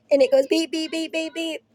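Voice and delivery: normal talking voice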